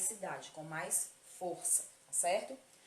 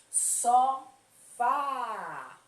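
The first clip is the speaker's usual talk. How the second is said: Sofá called out, long loud "fá"; stress on final syllable; 5 semitones higher, 12 dB louder than usual. Shown as a demonstration. Teacher showing pupils the strong syllable, stress on the "fá" of Sofá. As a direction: draw out and punch the end of Sofá. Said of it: "usam a força da voz pra falar".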